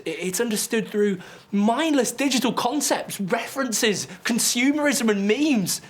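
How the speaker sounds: high-pitched, frustrated outpouring; agitated rant. Acted. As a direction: build to a desperate, worked-up peak. Monologue peaks in agitated rant.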